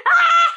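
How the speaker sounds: excited squeal. Real, not acted.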